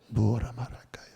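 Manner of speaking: hushed breathy whisper; whispered prayer. Retold or imitated. Brother prays in his sickbed, whispering. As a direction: whisper it like a weak, dying man. Quoting a dying man's prayer.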